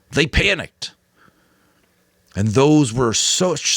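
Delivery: the Giver, earnest elder. A narrator's reading. The Giver explaining to Jonas why he must stay, earnest and urgent. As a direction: press the boy to understand the stakes.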